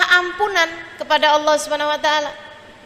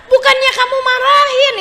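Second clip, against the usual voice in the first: ordinary talk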